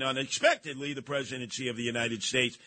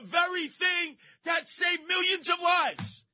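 indignant shout; 11 semitones higher than usual. Acted. Radio host yelling at Candace Owens over the vaccines, indignant shout. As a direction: tear into her with outrage.

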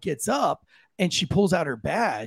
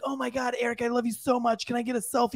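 lightly voiced breathless fan, higher and softer; gushing fan girl; 6 semitones higher, 3 dB quieter than usual. Girl fan gushing over comedian for a selfie, lightly mimicked high and soft. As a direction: gush at him like a starstruck fan. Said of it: "and she's like".

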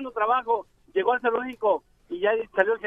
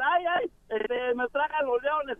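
mock panicked cry; 5 semitones higher, slower than usual. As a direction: cry for help in jest. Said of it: "empezó a gritar". (grito de auxilio fingido, sin fuerza)

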